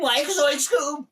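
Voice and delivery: high, loud, drawn-out cartoon voice; goofy high-pitched cartoon yelp; Scooby-Doo cartoon impression. Showing off an impression on a podcast, high Scooby-Doo cartoon voice. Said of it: "uh, s-, uh, Scooby-Doo behind him".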